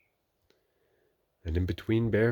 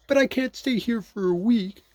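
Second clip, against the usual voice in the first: Pooh; 9 dB louder than usual. A narrator's reading. Pooh protesting.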